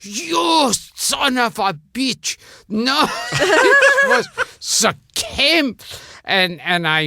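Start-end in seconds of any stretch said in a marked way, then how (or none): 0.0-5.8 mock furious shout in an accented older woman's voice, high and loud; slow, high, laughing yell of outrage. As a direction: bellow it like an outraged mother-in-law, slowly. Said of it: "And she'd go".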